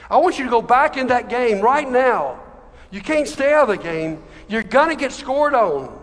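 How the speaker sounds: impassioned reassuring dad; fervent fatherly encouragement. A narrator's reading. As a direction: insist on your pride and send him back in. Father urges son back into game.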